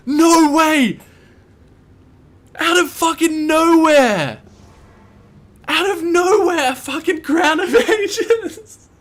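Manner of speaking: ecstatic high shouting; esports caster. Real, not acted. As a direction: scream with disbelieving joy. Rare item drop on stream.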